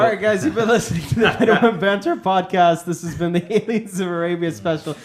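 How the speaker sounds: loud sign-off broken by laughter; laughing outro. Real, not acted.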